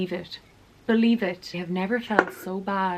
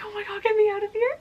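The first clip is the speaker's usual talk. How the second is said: panicked wail; 4 dB louder, much faster than usual. Retold or imitated. Horror seeing him at window.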